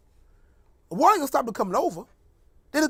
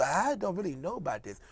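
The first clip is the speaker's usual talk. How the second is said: lower, softer sluggish mumble; sleepy Ben Carson impression; 6 semitones lower, 10 dB quieter than usual. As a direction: drift off like you're barely awake. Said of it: "He's so sluggish".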